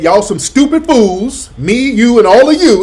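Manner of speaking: mock Roland rant. Mocking the rival host's insult to viewers, building loud. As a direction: parody the host's insult, rising in pitch and volume. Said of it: "Roland just said".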